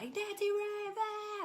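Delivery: high excited yell across a store. Retold or imitated. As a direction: shout her name in delight. Guy yelling across Costco.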